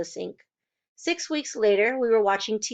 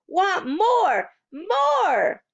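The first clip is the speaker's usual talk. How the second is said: put-on high, loud little-kid demand; a small boy wanting seconds; eager toddler; 10 semitones higher, 4 dB louder, much slower than usual. A narrator's reading. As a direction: beg for more like a greedy toddler. Toddler Fudge asking for more.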